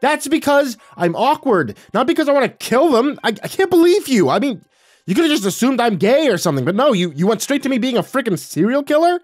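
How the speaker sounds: high, loud exasperated outburst; frantic, indignant shouting at mom. Acted. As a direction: yell in wounded disbelief. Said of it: "I shouted".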